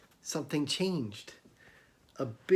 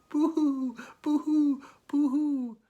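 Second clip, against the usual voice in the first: crying giant; 12 semitones higher, slower than usual. Acted. Giant crying in the museum, hammed-up high boo-hoo sobs. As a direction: wail like a big giant weeping far away. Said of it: "Somebody was crying".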